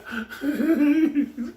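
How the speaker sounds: high-pitched laughing fit; real high, squealing laugh; helpless laughter. Real, not acted. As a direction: crack up at your own story. Cracking up at own story.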